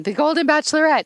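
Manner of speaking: mock yell from far away; imitating a shout. Host imitating husband calling from the garden, mock shout. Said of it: "Michael is shouting from the other end".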